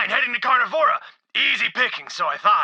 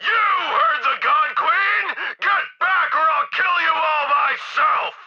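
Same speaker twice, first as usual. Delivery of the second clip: threatening shout. Acted. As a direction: bellow them back menacingly. Henchman orders the crowd back.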